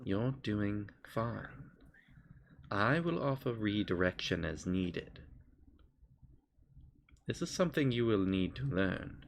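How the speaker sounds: patient guide, taking time between phrases; little voice. Acted. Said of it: "a little voice kind of comes up and goes".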